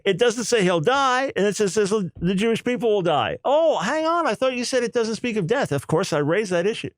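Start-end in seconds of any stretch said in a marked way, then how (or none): 0.0-3.4 higher-pitched retold insistence; emphatic. Rabbis insisting to the lecturer on their reading, retold emphatically. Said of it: "but they were emphatic". 3.4-5.7 much higher-pitched, retold objection; challenging retort. Lecturer challenging the rabbis' contradiction, retold in high-pitched protest.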